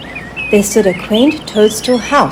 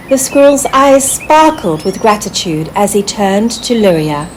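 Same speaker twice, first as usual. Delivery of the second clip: usual speaking voice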